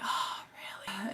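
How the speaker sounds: slow breathy whisper. Real, not acted. Standing at the empty shelf, slow whisper. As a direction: whisper it, deflated.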